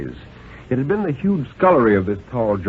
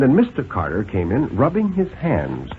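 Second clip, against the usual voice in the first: ordinary delivery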